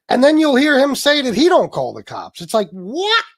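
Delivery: loud, high-pitched disbelief; incredulous. Real, not acted. Host scoffing at rival's claim that he never calls cops, incredulous. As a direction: build to an astonished, rising "what?".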